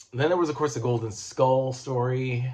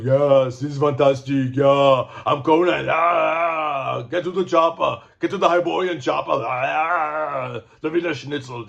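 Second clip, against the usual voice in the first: hammed-up Austrian action-hero impression, louder and higher; Arnold Schwarzenegger; 4 semitones higher, 5 dB louder than usual. Shown as a demonstration. Reader mocking a voice he won't use for Conan, hammed-up Arnold impression. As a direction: bellow it in a mock Austrian accent. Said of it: "I do not do Arnold Schwarzenegger".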